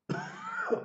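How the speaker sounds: strained squeak. Real, not acted. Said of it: "Not an easy voice to do".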